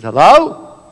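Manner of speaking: high, loud call up to someone above; calling out for help. A narrator's reading. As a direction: call out hopefully, pitch climbing at the end. Man hanging off branch calls out.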